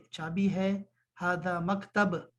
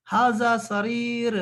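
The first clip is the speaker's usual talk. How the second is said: slow and louder, each word set apart; slow model phrase; 3 semitones higher, 5 dB louder, much slower than usual. Shown as a demonstration. Teacher presenting a new word to students, slow and loud. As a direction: spell out ہذا سریر slowly for the class. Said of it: "سریر کہتے ہیں چارپائی کو".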